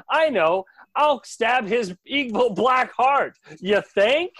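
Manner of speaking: high, loud, slow triumphant realization; Dean's eureka. Retold or imitated. Dean realizes the obvious plan in a high, loud, slow voice. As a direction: announce the obvious like a revelation. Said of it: "Dean has his great moment".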